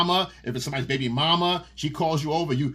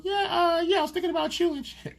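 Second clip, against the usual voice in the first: higher, faster coy wife voice; wife, caught by her husband, answering in a higher coy voice; 14 semitones higher than usual. Retold or imitated. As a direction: play the wife, caught fantasizing and covering.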